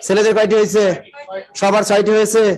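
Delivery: ordinary talk, nothing marked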